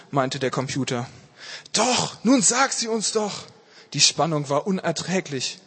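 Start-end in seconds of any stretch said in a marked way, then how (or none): 1.7-3.4 high, urgent plea; eager, pitched-up insistence; impatient pleading. A narrator's reading. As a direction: beg to be told right now. Impatient crowd begs for the answer.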